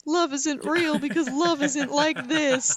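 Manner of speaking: high, slow, hammed-up despair; playing her own melodramatic tears for laughs; mock heartbroken wail. Telling her friend about crying over the movie, in a hammed-up sob voice. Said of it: "and I'm like".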